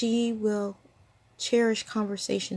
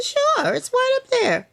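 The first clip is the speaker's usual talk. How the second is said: high, lightly voiced old friend; friend's bright reply; 7 dB louder, slower than usual. Old friend telling her where the teacup is, in a high voice. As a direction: lift the voice high to play the friend.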